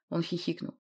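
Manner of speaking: plain reading voice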